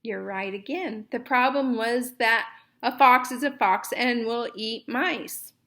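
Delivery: plain reading voice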